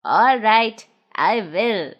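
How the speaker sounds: put-on low, older gnome voice; a low, fond old friend; Big Ears thanking Noddy. A narrator's reading.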